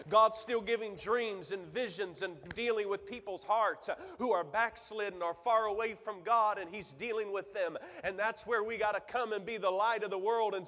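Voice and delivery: high, loud preaching build; loud, high preaching that keeps climbing; full-force preaching. Acted. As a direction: preach it to the back row, building.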